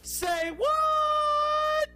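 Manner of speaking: very high, loud drawn-out squeal; high-pitched shout. Acted. Radio host mimics his segment's signature catchphrase, high-pitched shout. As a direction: shriek it sky-high, stretching the last word. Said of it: "that high-pitched voice says".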